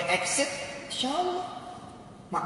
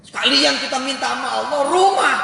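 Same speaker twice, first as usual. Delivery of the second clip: excited; 7 semitones higher, 9 dB louder, faster than usual. Retold or imitated. Follows the excited mock quote.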